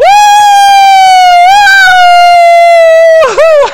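long, high, loud whooping laugh; big laugh. Real, not acted. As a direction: let out a huge shouting laugh. Laughing at the wailing ladies.

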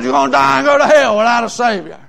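imitating a woman parroting the preacher; copycat echo. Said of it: "everything I'd say, she'd copy me".

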